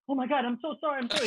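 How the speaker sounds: high-pitched pretend pleading; imitating a scared, caught-out gamer; mock panicked apology. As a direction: beg forgiveness in a hammed-up fluster. Acts out frightened gamer begging pardon.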